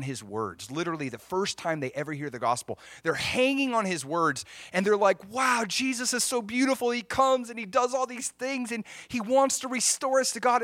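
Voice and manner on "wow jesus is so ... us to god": high, awed and delighted; a boy's excited, wide-eyed wonder; happy wonder. Retold or imitated. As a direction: gush about how beautiful it is. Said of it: "they're so happy hanging on the story".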